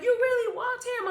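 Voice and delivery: high-pitched, loud disbelief; stunned brother. Retold or imitated. Brother to the child who walked home, stunned. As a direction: ask it amazed, voice way up. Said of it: "all I remember him saying".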